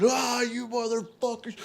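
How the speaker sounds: high-pitched mock scream, held back in volume; retold scream. Storyteller reenacting his rampage through the room, mock screaming.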